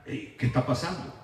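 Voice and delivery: very low, soft, tentative asking; hushed question. Acted. Paul and Barnabas asking what is going on, hushed question. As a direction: ask it under your breath. Acted puzzled question by the apostles.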